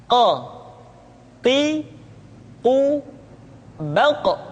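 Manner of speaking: "Qa, qi, qu, baq" heavy from the back of the tongue; thick qaf. Shown as a demonstration. Teacher demonstrating qaf, thick echoed "baq". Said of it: "Diucapkan dengan suara yang tebal".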